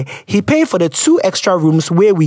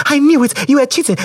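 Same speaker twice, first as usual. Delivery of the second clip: higher-pitched mock gotcha; mock accusation; 8 semitones higher, faster than usual. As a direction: act out catching a cheater.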